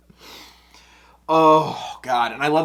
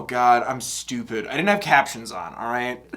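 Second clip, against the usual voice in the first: ordinary talk, nothing marked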